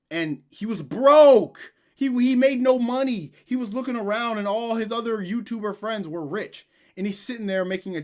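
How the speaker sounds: loud. Real, not acted. S1 ranting about Sneako.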